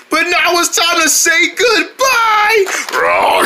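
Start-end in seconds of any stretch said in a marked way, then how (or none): 2.9-3.5 low breathy dinosaur roar; monster roar. Acted. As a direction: roar like a giant beast. Dinosaur attacking.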